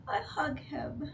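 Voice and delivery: slow, hushed whisper; tender whisper. Acted. Player hugging the crying father, slow whisper. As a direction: whisper it softly, moved.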